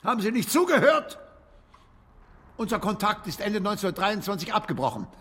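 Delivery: höher und etwas lauter, ungeduldig; gereizt. Acted. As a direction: genervt zurechtweisen. Gereizt über wiederholte Frage. (ungeduldig empört)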